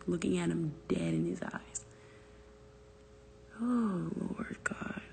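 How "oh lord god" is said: breathy whispered shock; hushed whisper. Real, not acted. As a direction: whisper it to yourself in disbelief.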